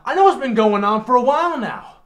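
angry husband. Acted. Betrayed husband shouts down his wife, angry. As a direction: cut her off with fury.

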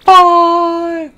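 five called high and loud as the count peaks. Pretend. Streamer counting carriage shells, high loud five.

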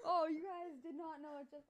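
laughing loudly through the words; nervous laugh after a scare. Real, not acted.

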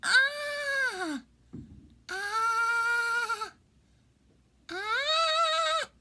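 high yelps of burning pain; pained scream. Real, not acted. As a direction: scream as the spice hits. Tongue burning from pepper flakes.

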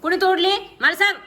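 loud, high-pitched demand; angry shouting. Real, not acted. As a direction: shout it as a cross interrogation. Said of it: "ओरडू नको". (मोठ्या, चढ्या आवाजात ओरडून जाब विचारत)

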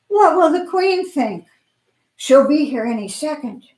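high, fretful cry about the Queen; panicked townsperson. Acted.

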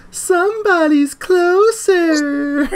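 drawn-out high mock cry; slow high wail. Golfer moaning on the course, high slow mock wail. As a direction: wail it long and high, laughing. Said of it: "when I'm playing golf".

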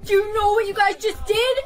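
high, loud, tearful dismay; horrified. Acted. Prank victim confronting her laughing friends, horrified. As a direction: cry out in upset disbelief.

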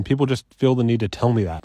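ordinary delivery

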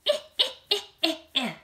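high cackling eh eh eh; witchy insect scratching cackle. Shown as a demonstration. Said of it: "he makes this sound".

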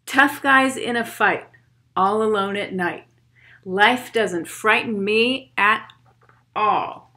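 put-on steady rhythmic poem read for kids. Reads poem aloud to children.

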